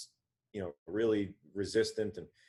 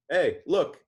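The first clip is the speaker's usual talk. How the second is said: much higher, louder eager call; excited call; 9 semitones higher, 8 dB louder than usual. Retold or imitated. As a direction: grab his attention, thrilled. Friend points to goosebumps excitedly.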